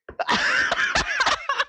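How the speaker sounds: shrill giggle up high; a high squeal of laughter at the memory. Real, not acted. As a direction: laugh way up in the head. Laughing at own story.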